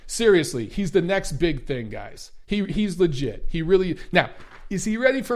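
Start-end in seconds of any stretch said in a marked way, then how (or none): none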